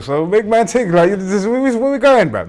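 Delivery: loud, high mocking jab; loud teasing. Real, not acted. Podcast host teasing guest about being broke, loud and high. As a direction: tease her loudly about being broke.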